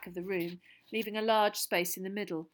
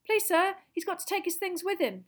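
put-on high, louder squeaky boy's voice; squeaking Colin; 12 semitones higher, 6 dB louder than usual. Boy squeaking at the teacher to let Harry take his bag. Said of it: "squeaked Colin".

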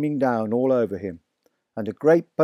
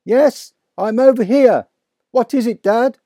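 higher and louder call; calling back; 9 semitones higher, 7 dB louder than usual. A narrator's reading. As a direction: call back through the dark.

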